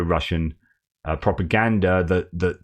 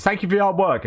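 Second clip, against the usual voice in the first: much higher, called out to a crowd; rallying shout; 11 semitones higher, faster than usual. Retold or imitated. Recruit leading his comrades in a chant, high call. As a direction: lead the chant, pitch it up high.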